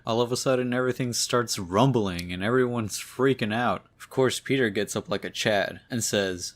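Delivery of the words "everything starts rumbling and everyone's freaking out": ordinary delivery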